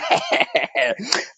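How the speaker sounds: a breathy, high little laugh; high soft laugh. Real, not acted. Host greeting listeners by name, breaking into a soft laugh. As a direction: laugh lightly to yourself.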